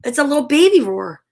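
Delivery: ordinary delivery, nothing marked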